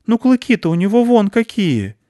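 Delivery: put-on high, loud childish objection; a small girl pointing at big tusks; piping indignant cry; little girl protesting. Аня protests about tusks.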